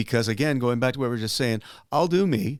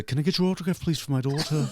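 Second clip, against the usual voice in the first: higher, amateur Liverpudlian impression; Liverpudlian accent; 5 semitones higher than usual. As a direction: attempt a polite Liverpudlian request. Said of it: "do a very good Liverpudlian accent".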